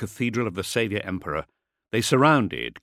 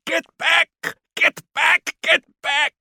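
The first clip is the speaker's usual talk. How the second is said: high-pitched, desperate repeated shouting; hoarse frustrated yell; 6 dB louder than usual. A narrator's reading. Kord yelling over and over at the crowd, hoarse and desperate. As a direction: yell it over and over, full of hate. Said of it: "Otmar Kord was yelling over and over".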